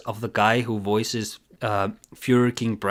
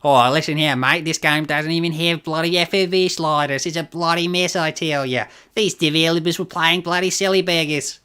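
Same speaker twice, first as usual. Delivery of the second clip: hammed-up Australian accent; 4 semitones higher than usual. Streamer redoing the game reviewer in a hammed-up Australian accent. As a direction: put on a broad mock Australian accent. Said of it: "Oh, he's Australian?".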